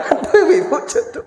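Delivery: words through real laughter; laughing. Real, not acted. Preacher laughing at the wife's answer, giggling.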